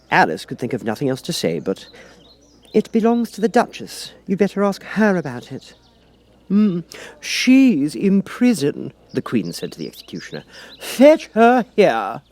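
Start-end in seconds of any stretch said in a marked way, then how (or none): 2.7-5.7 put-on high girlish voice; a young girl passing the buck; Alice voice. Alice suggests asking the Duchess, high and girlish. 6.5-8.9 very slow, higher, louder imperious voice; a haughty queen. Acted. The Queen tells the executioner, slow and imperious. 10.8-12.2 much higher, louder, very slow command; Queen's order. Acted. The Queen orders the executioner, slow furious command.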